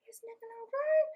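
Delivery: high breathy whisper aside. Real, not acted. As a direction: whisper your doubts to a friend. Friends judging him aside.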